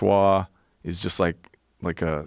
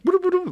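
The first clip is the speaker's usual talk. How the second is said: very high sing-song call; imitated jungle call; faster than usual. Imitating the guide's call through the jungle, very high and sung.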